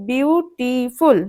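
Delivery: বিউটিফুল slowly in three parts; slow syllable-by-syllable বিউটিফুল; split into syllables. Shown as a demonstration. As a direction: separate বিউ, টি, ফুল. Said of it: "শব্দটা ভাঙো".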